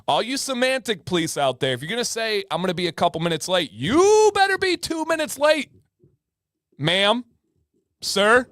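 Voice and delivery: high-pitched playful warning; hammed-up indignant telling-off; mock scolding. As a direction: scold them in jest, climbing to an indignant peak. Mock scolding of semantic police.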